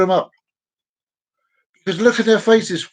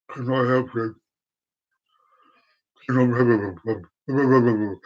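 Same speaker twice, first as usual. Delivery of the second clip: very low, slow dopey shop assistant; mock a sluggish bloke on the till; low mumbled drone, slurring slower; till lad impression; 10 semitones lower, 4 dB quieter, slower than usual. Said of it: "this is my impression of this bloke".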